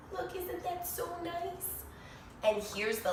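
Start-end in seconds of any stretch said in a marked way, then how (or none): 0.1-1.8 gentle, soft remark; soft aside. Real, not acted. Reader sharing the happy ending softly with the child. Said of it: "reader comments to child".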